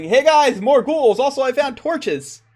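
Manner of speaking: loud, high-pitched mock shout from up above; Erasmus calling out. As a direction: imitate a player's character hollering to the party. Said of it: "you hear up above".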